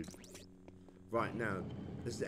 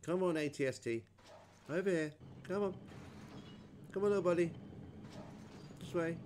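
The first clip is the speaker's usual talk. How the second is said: higher-pitched call to a companion; 7 semitones higher than usual. Real, not acted. Gamer to his little buddy, come on this way, calling out. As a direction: coax a straggler along from a little way off.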